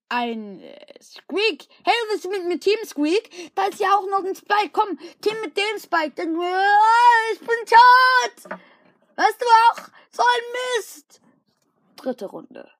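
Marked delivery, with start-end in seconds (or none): 1.8-11.2 very high, loud whiny wail; mock-cry about dying; hammed-up tearful whine. Wailing after dying.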